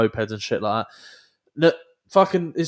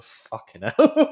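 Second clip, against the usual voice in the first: slow, much higher disbelieving fucking hell; amazed exclamation; 13 semitones higher, 4 dB louder, much slower than usual. Retold or imitated. Seeing the old lads still at it, a drawn-out high amazed cry. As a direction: stretch it out high like you can't believe your eyes. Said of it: "and I was like".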